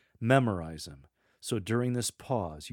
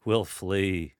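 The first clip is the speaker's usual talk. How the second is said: loud, derisive answer with a hint of chuckle; dismissive, mocking; 6 dB louder than usual. Shown as a demonstration. Lector mocking the mere mention of sadness, dismissively. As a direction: brush it off, mocking the very idea. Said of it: "little bit of a chuckle in your voice, dismissively".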